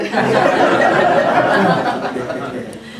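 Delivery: loud airy laugh; breathy laugh. Real, not acted. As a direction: break into laughter. Laughing at Sandy's temper.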